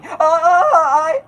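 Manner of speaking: high, slow, panicked stammer; Morty stammer. Retold or imitated. Morty stuck for words before Rick, a high slow stammer.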